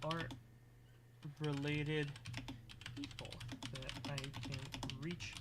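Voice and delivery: very soft, halting dictation; composing aloud. Real, not acted. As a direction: murmur word by word while writing. Composes Discord message aloud.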